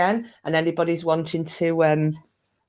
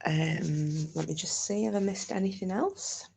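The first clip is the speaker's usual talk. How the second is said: soft, half-whispered mutter; a soft aside under the breath; quiet aside; 13 dB quieter than usual. Real, not acted. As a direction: drop to a hush while checking. Quiet aside while checking chat.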